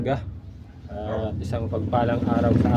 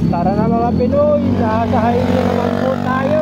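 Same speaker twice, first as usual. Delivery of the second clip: raised voice; 5 dB louder than usual. Real, not acted. Riding on highway in traffic.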